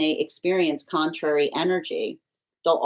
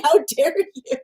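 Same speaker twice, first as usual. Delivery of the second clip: much higher, hammed-up how dare you; mock-outraged guide; 11 semitones higher, 3 dB louder than usual. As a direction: play a scandalised spirit, half laughing. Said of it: "They're going".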